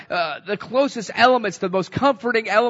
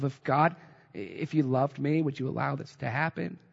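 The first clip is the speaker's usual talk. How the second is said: doubting murmur; 5 semitones lower, 8 dB quieter than usual. Retold or imitated. Voicing doubt in darkness.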